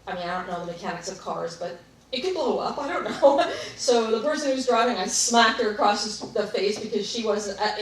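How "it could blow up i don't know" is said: real laugh breaking into the words; louder, higher, talking through laughter; amused admission with a laugh. Real, not acted. Laughs at her own ignorance of cars.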